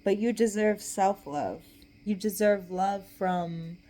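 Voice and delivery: usual speaking voice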